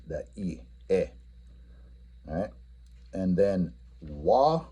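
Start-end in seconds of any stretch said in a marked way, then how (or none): 0.1-1.1 "E" said alone, short and clear; teacher pointing out the stressed first letter. Shown as a demonstration. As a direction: isolate the first "e" sound. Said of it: "the stress is on the very first letter".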